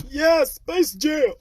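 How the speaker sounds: gushing over Space Jam, slow high excitement; excited. Real, not acted. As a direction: stretch it out with glee.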